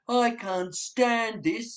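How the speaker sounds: slow, drawn-out exasperated bear; fed-up Mister Bear. Acted. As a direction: drag it out, sick of the noise. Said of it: "said Mister Bear".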